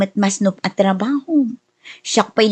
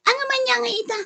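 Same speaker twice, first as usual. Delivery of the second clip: high, softer exasperated complaint; 12 semitones higher, 5 dB quieter, faster than usual. Acted. Wife griping to husband about their life, exasperated. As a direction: throw up your hands at how things turned out.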